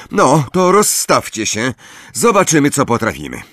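plain reading voice

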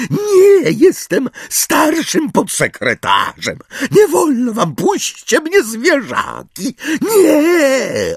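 high, panicked shrieking protest; Umbridge. A narrator's reading. Umbridge protests as centaurs grab her, screaming. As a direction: scream in terror while pulling rank. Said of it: "rozdzierający krzyk Umbridge".

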